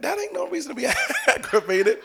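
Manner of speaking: high-pitched retort breaking into laughter; laughing protest. Retold or imitated. Believer answering the Holy Spirit, laughing high-pitched protest.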